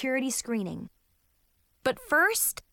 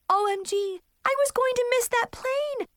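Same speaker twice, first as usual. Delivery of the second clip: high, panicked squeal; sudden alarm at missing the plane; 13 semitones higher than usual. Acted.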